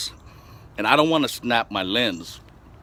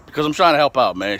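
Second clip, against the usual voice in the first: normal talking voice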